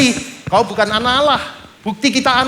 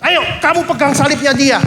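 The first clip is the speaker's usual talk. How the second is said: soldier's order; 5 semitones higher than usual. Acted. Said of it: "Ada tentara-tentara Romawi narik".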